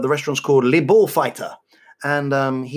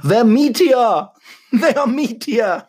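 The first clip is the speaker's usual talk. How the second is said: louder, slow, higher, breaking into laughter; punchline delivered laughing, higher and louder; 7 semitones higher, 5 dB louder, slower than usual. Real, not acted.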